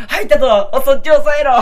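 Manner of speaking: shouting orders, laughing; excited shout. Pretend. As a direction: yell to the crew mid-laugh. Acting staff shouting in scene.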